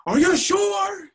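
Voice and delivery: very high, loud, drawn-out question; challenging shout. Retold or imitated. Professor doubting the student's correction, high loud challenge. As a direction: stretch out a high, doubting cry. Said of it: "He says".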